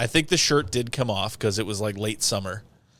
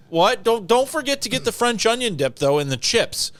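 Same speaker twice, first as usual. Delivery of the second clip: higher-pitched mock wife voice; wife on the phone reminding him, higher voice; imitating the wife; 9 semitones higher than usual. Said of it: "And she's like".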